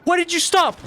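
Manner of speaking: shocked the thing just quit on you; startled frustration. Real, not acted. Game suddenly stops, frustrated.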